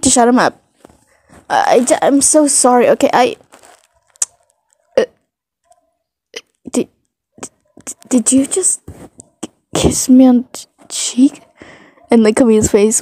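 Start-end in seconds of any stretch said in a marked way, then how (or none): 1.5-11.4 stunned stutter, fading to near whisper in places; flustered stammering. Acted. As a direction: break up the words as if dumbstruck. Flustered after a surprise kiss.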